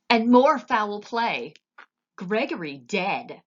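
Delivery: loud, slow cry of alarm; slow, loud shout of alarm. A narrator's reading. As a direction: bellow the bad news across the kitchen. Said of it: "shouted Cook".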